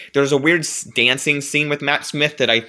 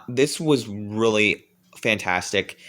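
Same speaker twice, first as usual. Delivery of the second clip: normal talking voice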